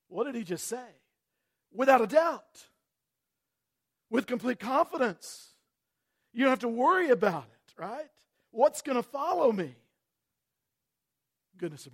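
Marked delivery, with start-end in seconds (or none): 1.7-9.8 higher, louder pulpit cry; full-force preaching. Acted. Pastor assuring the congregation, loud and high. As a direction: proclaim it with conviction.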